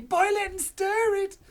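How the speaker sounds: high, loud excited cry; Mister Cranky cries out high and loud; cried out. Acted. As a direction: yell it over the stove. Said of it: "cried Mister Cranky".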